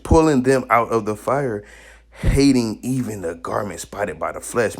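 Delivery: slow thundering sermon read; forceful scripture reading. Acted. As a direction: proclaim the verse with weight. Reads scripture at full force.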